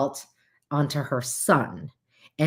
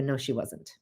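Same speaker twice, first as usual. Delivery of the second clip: sarcastic; 5 semitones lower, 10 dB quieter than usual. Acted. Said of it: "and I'm being sarcastic".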